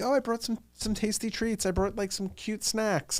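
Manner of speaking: high, soft little voice; cutesy Sag girl. Retold or imitated. Sag girl arriving with treats, high cutesy mock voice. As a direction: imitate a sweet girl offering treats, high and softly. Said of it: "They'd be like".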